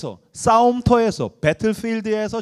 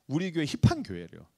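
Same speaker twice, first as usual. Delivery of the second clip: usual speaking voice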